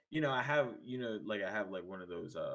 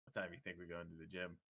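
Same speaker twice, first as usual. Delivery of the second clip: low, soft aside to a friend; hushed aside; 6 semitones lower, 13 dB quieter than usual. Retold or imitated. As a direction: drop your voice away from the phone. Aside to friend, phone put down.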